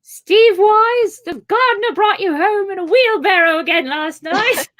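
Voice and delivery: high, loud nagging housewife voice; scolding hobbit wife. Pretend. Wife scolding Steve Wise over the wheelbarrow, shrill high nagging. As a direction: shriek at the drunk husband come home.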